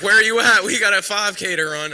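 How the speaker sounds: high loud retold call; excited shout. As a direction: call out loudly, hurrying a friend. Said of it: "He's like".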